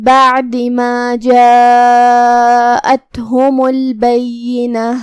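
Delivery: very slow melodic tilawat with long held vowels; Quran recitation. Acted. Teacher reciting the surah in full for listeners, slow melodic chant. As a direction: chant each phrase slowly with tajweed. تلاوت تجوید کے ساتھ سماعت فرمائیں.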